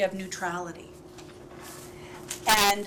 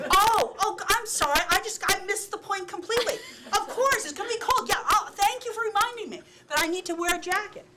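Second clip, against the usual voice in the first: much higher, laughing mock gratitude; sarcastic kid; 9 semitones higher than usual. Parent mocking a kid who suddenly sees reason, high sarcastic gush. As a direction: ham up an overly grateful child.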